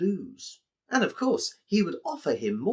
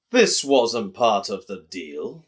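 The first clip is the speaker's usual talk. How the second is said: slow, loud protest from a gentle giant; a big man digging in his heels; lightly voiced giant, slow and loud; indignant giant; 3 semitones lower, 8 dB louder, slower than usual. Giant Mo objects to cheat.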